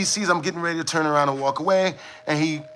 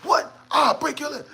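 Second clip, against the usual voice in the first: mock a man picking a fight; imitating a man yelling at a stop sign; homeless man yelling; 10 semitones higher than usual. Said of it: "yelling at the stop sign".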